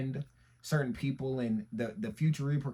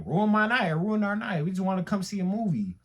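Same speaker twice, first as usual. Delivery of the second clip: higher-pitched plea, a bit louder at first; retold complaint; 7 semitones higher, 4 dB louder than usual. Telling the officer about the spoiled night, pleading. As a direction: plead your case in a raised pitch.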